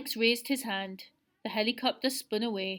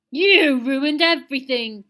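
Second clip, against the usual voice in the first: a loud, furious howl, pitched higher; howling in rage; 4 semitones higher, 12 dB louder than usual. A narrator's reading. Seal to Alex after his defeat, howling with rage. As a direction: Seal erupts, raging at the boy who beat him. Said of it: "Seal howled".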